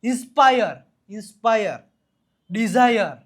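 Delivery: ઇન્સ્પાયર and ડિઝાયર loud, stressed on the eye-uh ending; demonstrated vowel glide. Shown as a demonstration. Teacher drills students, ઇન્સ્પાયર and ડિઝાયર loud on the eye-uh glide. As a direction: punch out the પાયર in ઇન્સ્પાયર and ઝાયર in ડિઝાયર. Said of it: "આ એ એવો ઉચ્ચાર થશે".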